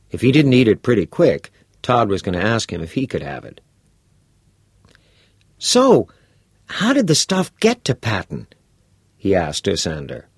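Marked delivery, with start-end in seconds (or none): 5.6-8.5 put-on bright, curious teenage boy; a keen schoolboy pressing for details; eager boy voice. Todd's eager questions.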